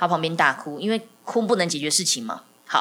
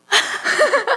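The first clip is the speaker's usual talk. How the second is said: short high laugh; 4 dB louder than usual. Real, not acted. Laughing mid-quote.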